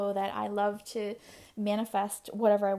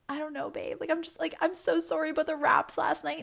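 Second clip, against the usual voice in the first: higher voice, pleading and rising; imitating own anxious apology; 7 semitones higher, 3 dB louder than usual. She apologizes again to boyfriend about the wraps, higher anxious. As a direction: replay your anxious sorry to him.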